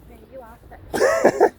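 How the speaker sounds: high, loud jokey yell; mock-outraged squeal at her friend; teasing shout. Real, not acted. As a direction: shout the comeback playfully. Teasing banter, shouted back.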